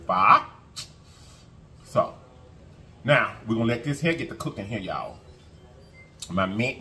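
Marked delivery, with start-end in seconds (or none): 0.1-0.4 "Pho" as "fuh?", tone climbing like a question; Vietnamese pho pronunciation. Shown as a demonstration. Vlogger quoting the nail technician, "Pho" as rising "fuh?". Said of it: "that's how they say it in that country".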